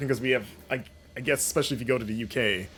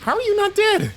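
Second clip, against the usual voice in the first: much higher, louder squeal of disbelief; real exasperated shout; incredulous yell; 10 dB louder than usual. Real, not acted. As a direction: shriek it at the enemy who won't die. Enemy survives his shots again.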